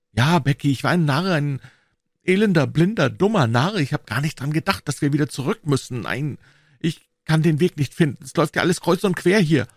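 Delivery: angry at himself; boy admitting they are lost; self-reproaching boy. A narrator's reading. Tom blames himself.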